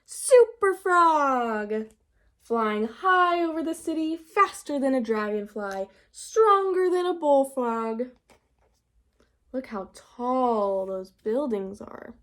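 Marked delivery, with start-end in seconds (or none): 0.0-8.1 big, loud superhero proclamation, stretched out; heroic boast. Acted. Froggy dreaming up his costume, in a grand heroic voice. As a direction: boom it out like a comic-book hero.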